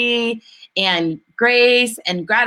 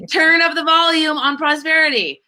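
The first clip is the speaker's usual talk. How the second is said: excited shout; 6 semitones higher than usual. Real, not acted. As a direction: call it out with joy. Cheering the teaching on.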